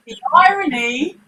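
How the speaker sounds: rueful dad caught out; ironic self-mockery. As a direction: groan at your own hypocrisy. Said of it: "he's like".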